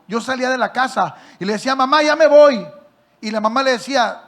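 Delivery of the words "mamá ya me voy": loud call to mother, falling at the end; calling out goodbye. Retold or imitated. Son calling goodbye to his mother, loud call. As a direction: call it out across the house.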